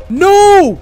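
sudden loud no; a sharp panicked no; shout. Real, not acted. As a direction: yell it out in alarm. Trap springs on player.

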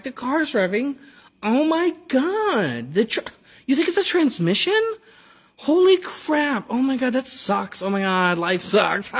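high, drawn-out fake concern; mock sympathy. Husband mocking concern when wife calls about car, high and sarcastic. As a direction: overdo the shock sarcastically. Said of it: "And I was like".

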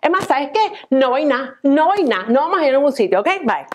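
high indignant huff; flouncing off in a sulk; high-pitched indignant refusal and dismissal; huffy girlfriend. Acted. Girlfriend storming off.

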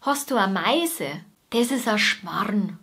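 broad Bavarian dialect, relaxed and homely; a born Bavarian imitating her home dialect; Bavarian accent. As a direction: talk like someone from rural Bavaria. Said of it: "deshalb kann ich das auch ganz gut imitieren".

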